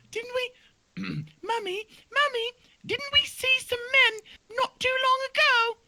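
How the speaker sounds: put-on very high whiny kid; a small fawn tugging at mum; high childlike fawn voice; squeaky fawn child.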